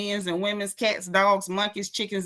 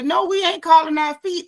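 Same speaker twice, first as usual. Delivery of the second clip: mock outraged; 7 semitones higher, 5 dB louder than usual. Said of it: "And I'm like".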